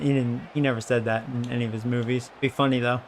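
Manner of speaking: ordinary delivery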